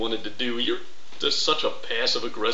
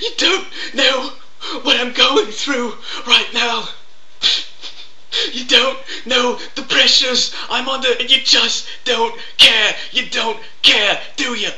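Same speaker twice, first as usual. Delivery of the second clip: much higher, louder whining complaint; whiny Coughlin; 12 semitones higher, 5 dB louder than usual. Acted. Impression complains to the YouTuber, high loud whine. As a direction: whine self-pityingly at the critic. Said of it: "here's my impression".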